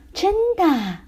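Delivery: high, elated exclamation; joyful cheer. Acted. Child learning dad still has the money, cheering. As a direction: cheer with delighted surprise. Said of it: "谢里尔欢呼起来".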